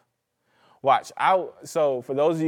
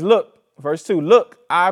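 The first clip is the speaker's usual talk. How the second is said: loud preaching call; 4 semitones higher, 11 dB louder, slower than usual. Acted. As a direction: shout "Look" much louder and higher. Preacher reading Paul's command.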